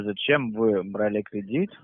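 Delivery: slow, spelled-out repeat; slowly, as if to someone deaf; to someone hard of hearing. Real, not acted. Repeats question after "Ещё раз.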